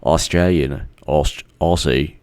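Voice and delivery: low, soft stab at Australian; tentative, slowing Australian accent; attempted Australian accent. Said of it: "I'm trying to do the Australian".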